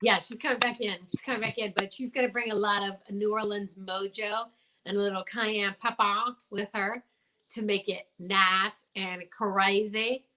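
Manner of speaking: ordinary delivery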